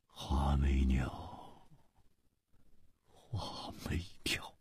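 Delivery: slow, barely voiced musing; wry whisper. A narrator's reading. Ji mulling over the killer's name, whispering wryly. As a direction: breathe the name out slowly, fading away. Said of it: "苦笑着说".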